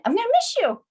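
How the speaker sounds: cracking high on miss you; voice breaking. Real, not acted. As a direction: emotion breaks through the words. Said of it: "I'm emotional".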